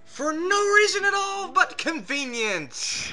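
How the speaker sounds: high, slow, loud mock royal proclamation; booming princess voice. Mocking Luna's booming voice.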